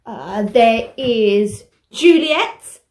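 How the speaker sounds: normal talking voice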